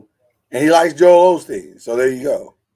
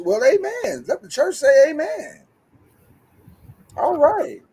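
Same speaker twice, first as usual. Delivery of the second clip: mock preacher; 12 semitones higher, slower than usual. Preacher-style call for amen.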